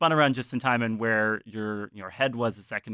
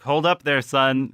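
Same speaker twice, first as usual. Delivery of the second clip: J P; 3 semitones higher, 9 dB louder than usual. Acted. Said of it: "I think J P's like".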